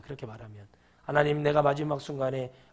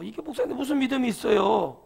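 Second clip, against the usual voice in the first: ordinary talk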